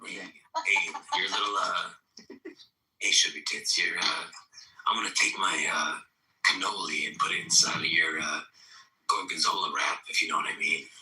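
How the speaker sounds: low, breathy sleazy come-on; requested character voice. Shown as a demonstration. Playing a sleazy flirt on request, low character voice. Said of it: "Say something about your Peter pocket".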